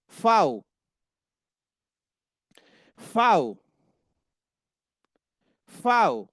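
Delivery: fow, starting with a crisp f; German V as fow. Shown as a demonstration. Teacher drilling the German letter V, emphatic. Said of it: "वो एफ की तरह काम करता है".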